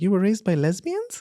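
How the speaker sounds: lightly voiced incredulous Westerner; a hint of a shocked foreigner; mock Westerner. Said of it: "as the Westerners, like".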